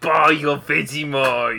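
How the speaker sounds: loud, drawn-out Aussie sales yell; mock Australian accent. Co-host selling in a fake advert, shouted Australian accent. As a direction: holler it slowly, broad Australian. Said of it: "say it in an Australian accent".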